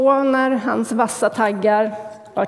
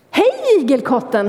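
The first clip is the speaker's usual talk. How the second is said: cheerful greeting; 4 semitones higher than usual. Acted. Swallow greets hedgehog from tree, high glad hello. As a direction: greet him gladly from the tree. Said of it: "svalans glada röst".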